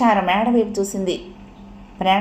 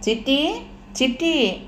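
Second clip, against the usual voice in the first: higher-pitched affectionate call; 6 semitones higher, slower than usual. A narrator's reading. Old mother calls her daughter as she comes in, high call. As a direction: call out a pet name, rising then falling.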